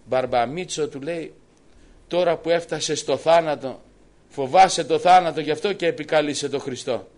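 loud, scornful shout from the woman; a woman jeering at the dying old man; mocking taunt. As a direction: hurl it at him in derision.

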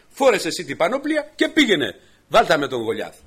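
loud, high, bold dare to the king; boy daring the king, high and loud; defiant challenge. Acted. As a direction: throw the armour back at him, loudly. David challenges King Saul.

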